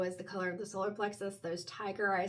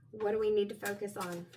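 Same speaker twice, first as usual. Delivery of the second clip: ordinary talk, nothing marked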